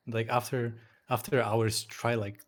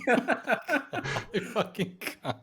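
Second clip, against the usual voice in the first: slow, breaking into laughter; can barely get it out laughing; 12 semitones higher, much slower than usual. Real, not acted. Laughing fit after Gdansk mate.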